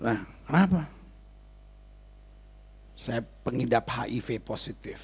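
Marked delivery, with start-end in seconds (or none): 3.0-5.0 slow, low and soft retold admission; hushed, halting disclosure; grave confession. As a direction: imitate a woman quietly confessing. Voices tearful woman's confession.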